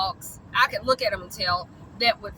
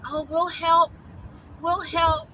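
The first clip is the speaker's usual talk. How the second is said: mocking quote; 5 semitones higher, slower than usual. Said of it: "they act like".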